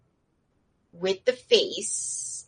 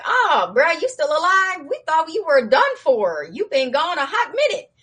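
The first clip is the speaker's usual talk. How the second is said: teasing guard; 8 semitones higher, 4 dB louder than usual. Acted. Teasing guards greet returning agent.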